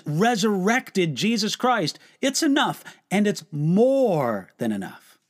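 normal talking voice

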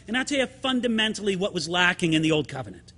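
usual speaking voice

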